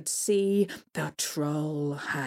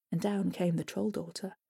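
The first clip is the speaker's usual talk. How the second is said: plain reading voice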